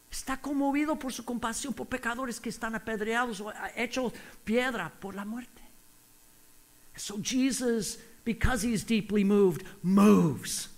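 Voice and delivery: ordinary talk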